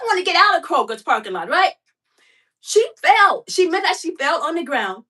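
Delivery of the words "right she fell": normal talking voice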